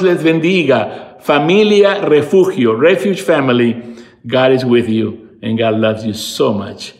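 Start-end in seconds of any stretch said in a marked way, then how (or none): none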